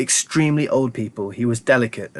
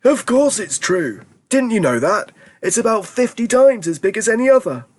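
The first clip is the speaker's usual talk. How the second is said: put-on an old grandparent insisting with glee; eager old grandparent; 10 semitones higher than usual. A narrator's reading. Grandparents correcting Charlie, high and eager.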